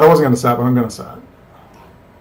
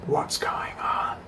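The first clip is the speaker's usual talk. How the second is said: a sudden breathy whisper to himself; 13 dB quieter, much slower than usual. Real, not acted. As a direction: drop to a secret whisper. Aside amid his conspiracy questions.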